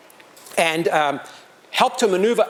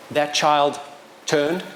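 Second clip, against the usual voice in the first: usual speaking voice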